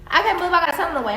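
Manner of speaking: rattled off quickly, mocking his words; fast mocking repeat. Woman mocking her ex's baby announcement, fast and mocking. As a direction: rush through it like you're sick of hearing it.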